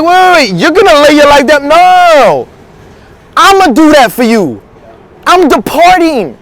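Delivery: incredulous protest. Acted. Jesus corrects Peter, acted.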